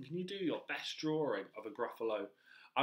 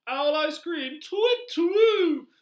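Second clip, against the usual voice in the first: high, loud hooting owl; alarmed owl; 15 dB louder, much slower than usual. Acted. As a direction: hoot out in mock alarm. Owl takes fright and hoots.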